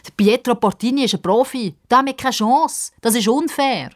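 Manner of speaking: high, whining complaint; indignant protest. Acted. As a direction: object that it's not fair. Boys protest against facing a professional.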